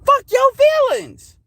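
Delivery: slow high taunting repeat; defiant. Real, not acted. Repeats defiant rant line.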